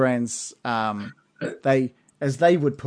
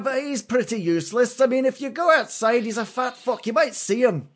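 mocking thug; 10 semitones higher, 3 dB louder than usual. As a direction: laugh him off like a cocky local ruffian. Thug mocks call for police.

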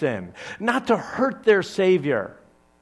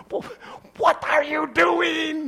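much higher, drawn-out indignant shout; 12 semitones higher, much slower than usual. Acted. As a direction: cry it out, scandalized, slowly. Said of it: "the Pharisee and even the disciples saying".

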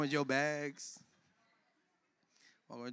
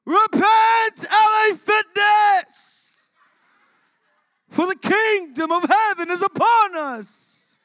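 loud, high shouted mock doom-preaching; mock street preacher; 21 dB louder, much slower than usual. Pastor mocking a gym-floor preacher, hammed-up shout. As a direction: bellow it like a street-corner prophet. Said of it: "this is what I'm not saying to do".

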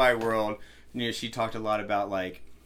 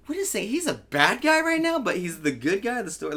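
high-pitched, baffled retelling; mock bewilderment, pitched up; incredulous protest; 9 semitones higher than usual. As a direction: puzzle over it in a raised voice. Acted puzzled reaction to story.

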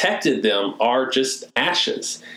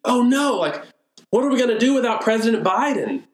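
shocked reaction; 8 semitones higher than usual. Pretend. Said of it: "your natural reaction would be".

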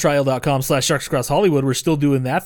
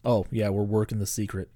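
ordinary delivery

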